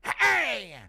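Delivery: very high, slow, stretched shout; Yoel Romero. Acted. Streamer impersonating a fighter joining Maori culture, drawn-out high shout. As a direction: holler it in a loud caricature voice. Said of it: "Impression of Yoel Romero joining Maori culture".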